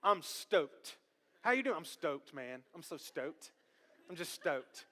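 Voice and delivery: lazy eighties dude talk; mock surfer dude. Preacher mimicking an eighties dude, soft and low. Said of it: "words like stoked".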